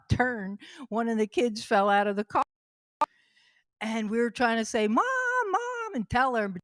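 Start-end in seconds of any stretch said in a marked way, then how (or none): 4.9-5.9 high urgent call to mom. Retold or imitated. Kids in the back seat trying to warn mom, high call. Kids calling to driving mother.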